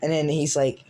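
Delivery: ordinary delivery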